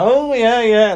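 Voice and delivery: high, loud humouring yeah; awkward humouring. Retold or imitated. Student humouring the drunk, high and bright. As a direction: humour the odd stranger.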